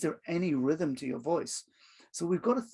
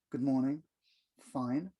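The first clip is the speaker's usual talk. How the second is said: low, subdued half-hearted "fine"; downbeat; 3 semitones lower, 4 dB quieter than usual. Shown as a demonstration. Grumpy employee answering a morning greeting at the office, downbeat. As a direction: answer quietly, giving the bad mood away. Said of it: "your tone is giving away everything".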